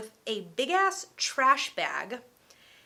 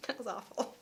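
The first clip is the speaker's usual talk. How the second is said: ordinary talk, nothing marked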